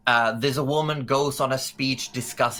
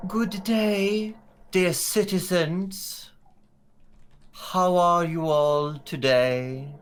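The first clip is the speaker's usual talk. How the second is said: regal woman; 7 semitones higher, 3 dB louder than usual. Acted. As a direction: greet the crowd grandly, with long pauses. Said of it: "this regal-looking woman".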